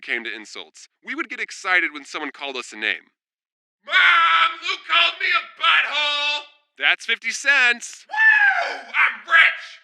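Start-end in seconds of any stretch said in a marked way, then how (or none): 3.9-6.4 much higher, loud gleeful yell; tattling shout. Pretend. Kid tattling on brother to mom, gleeful shout. 8.1-9.8 very high, loud whoop; excited cheer. Pretend. Kid celebrating fifty cents, loud joyful whoop.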